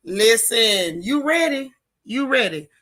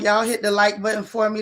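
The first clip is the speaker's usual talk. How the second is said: ordinary talk, nothing marked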